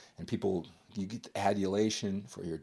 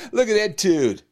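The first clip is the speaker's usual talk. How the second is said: louder, higher scoffing gym-bro voice; mock gym bro; 9 semitones higher, 9 dB louder than usual. Bodybuilder mocking a TV star's body, loud higher sneer. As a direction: sneer at the actor like a cocky lifter. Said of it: "and you go".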